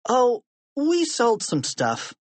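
high, slow, innocent-sounding answer; evasive boy. A narrator's reading. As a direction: draw it out high, playing innocent.

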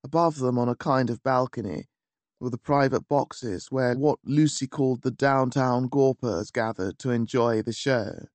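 ordinary delivery

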